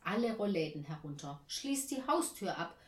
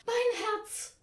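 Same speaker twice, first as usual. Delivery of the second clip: high, louder exclamation of shock; startled cry; 7 dB louder than usual. A narrator's reading. Aunt startled by the sudden telephone, crying out in fright. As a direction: cry out as if your heart jumps. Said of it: "ruft Tante Ernie".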